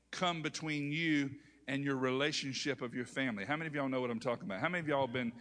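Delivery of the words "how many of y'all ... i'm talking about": usual speaking voice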